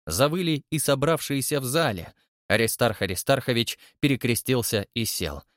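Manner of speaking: usual speaking voice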